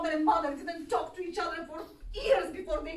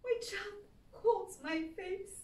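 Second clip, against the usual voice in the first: ordinary talk, nothing marked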